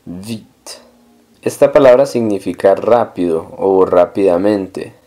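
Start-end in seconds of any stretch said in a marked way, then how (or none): 0.1-0.5 vite said softly as "veet"; sounded T, silent E. Shown as a demonstration. Teacher shows the ending of vite, T sounded. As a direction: release the T of vite, no E after it. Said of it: "pero sí suena la T".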